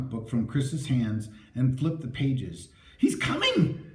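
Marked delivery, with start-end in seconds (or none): 3.0-3.8 terrified high shriek; panicked high-pitched shriek. A narrator's reading. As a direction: scream the warning in terror. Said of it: "Chris shrieked".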